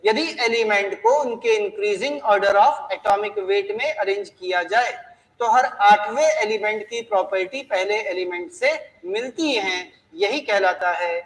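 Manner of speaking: law read out slowly and clearly; slow dictation. Shown as a demonstration. Teacher repeating the law to students, slow and deliberate. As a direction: spell it out slowly for the class. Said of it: "फिर से सुनिए क्या बोला".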